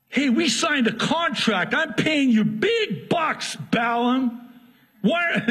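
a cheated client yelling at his hire; furious Balak. Acted.

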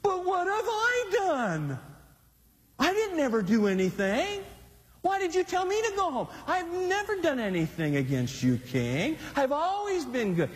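high, pleading protest of innocence; mock-innocent whining plea to the king; acted innocent plea. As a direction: play the wronged innocent, voice rising high. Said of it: "this is acting at its ultimate".

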